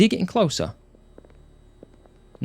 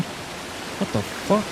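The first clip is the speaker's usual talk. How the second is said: scared whisper; 9 semitones higher, 6 dB quieter, much slower than usual. Real, not acted. Startled by strange sound.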